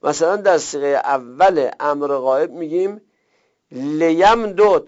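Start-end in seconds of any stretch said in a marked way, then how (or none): 3.7-4.8 لیمدد slowly, as le-yam-dod with both d's apart; unmerged base form. Shown as a demonstration. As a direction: spell out لیمدد without merging the d's. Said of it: "این که خودشه". (لیمدد آهسته و بدون ادغام)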